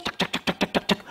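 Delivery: breathy clicking beads, barely voiced; whispered abacus clicks. Preacher imitates abacus beads, whispered clicks. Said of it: "Simpo aja".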